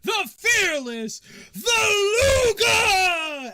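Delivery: booming, drawn-out, high-pitched announcer shout; fight announcer. Acted. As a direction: announce the winner to a roaring arena, stretching every name. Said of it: "you hear the announcer goes".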